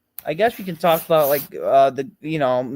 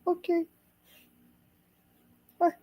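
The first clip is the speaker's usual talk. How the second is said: high silly voice; 13 semitones higher than usual. Pretend.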